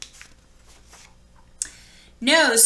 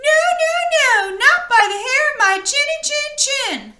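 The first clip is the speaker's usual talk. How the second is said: very high, loud, squealing pig voice; squeaky little pig; 4 dB louder than usual. Acted. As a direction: squeal it like a tiny panicked pig. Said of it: "the little pig replied".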